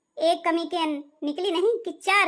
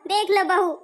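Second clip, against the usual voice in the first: normal talking voice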